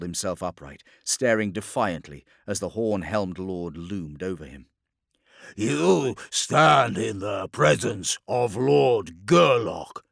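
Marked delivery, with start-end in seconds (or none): 5.5-10.0 loud, drawn-out snarling proclamation; monstrous snarl. A narrator's reading. Terminator announcing his lord to the captive, snarling. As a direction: snarl it slowly, pitched up. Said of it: "snarled one of the Terminators".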